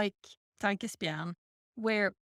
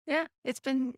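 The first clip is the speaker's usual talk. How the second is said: normal talking voice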